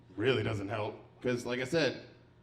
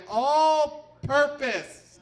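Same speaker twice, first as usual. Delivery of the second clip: very slow, high, dazed; slow stunned answer; blank drawn-out reply; 10 semitones higher, 5 dB louder, much slower than usual. Retold or imitated. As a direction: stretch it out, lost for words.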